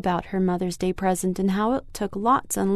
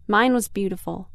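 plain reading voice

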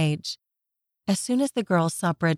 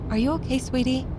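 higher, gentle mum voice; caring mother; 6 semitones higher, faster than usual. Acted. Mother asks worried daughter.

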